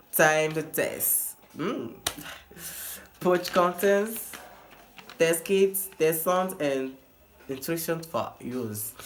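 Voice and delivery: slow, halting French-accented English. Real, not acted. Vlogger reading the English test notice to viewers, accented English. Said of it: "mon accent est un peu bizarre".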